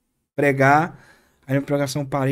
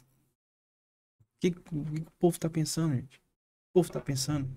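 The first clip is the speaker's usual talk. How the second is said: softer, slowed incredulous question; quiet exasperated rebuke; hushed disbelief; 3 semitones higher, 6 dB quieter, slower than usual. Retold or imitated. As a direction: murmur it in disbelief. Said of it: "Falei assim".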